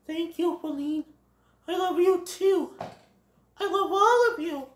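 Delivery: high, sweet character voice; touched Bambi. Acted. Bambi thanks friends who love him, high touched voice.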